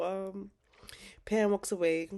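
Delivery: ordinary talk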